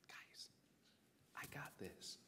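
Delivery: whispered, cool and casual; calm whisper. Acted. Jesus to panicked disciples in the boat, a cool whisper. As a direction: hush them, totally unbothered.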